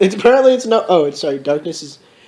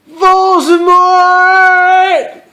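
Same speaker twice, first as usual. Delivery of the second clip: long, high, stretched-out cry; dramatic announcement; 10 semitones higher, much slower than usual. Acted. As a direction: draw the name out in hammed-up dread. Villain's dramatic arrival.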